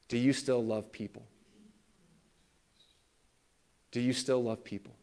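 low, soft, hushed question; quietly voiced God. God quietly asks the angry pastor, hushed. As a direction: ask it gently, with a long pause before repeating. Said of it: "he felt God kinda quietly whisper to him".

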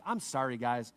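low, sheepish aside; embarrassed apology. Acted. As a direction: apologize under your breath for a friend. Jesus apologizes for embarrassing Peter.

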